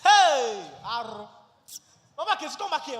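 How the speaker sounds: very loud call after her; angry shouting. Acted. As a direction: bellow at your departing wife. Husband shouts after departing wife.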